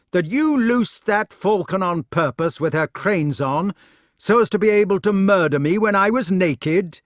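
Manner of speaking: high, loud indignant question. A narrator's reading. Tricked knight to his attacker below, accusing loudly. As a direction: call the accusation down.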